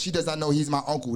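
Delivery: fast, even "He does not know he's my uncle"; rapid recitation. Real, not acted. Teacher running through the five degrees, rapid recitation.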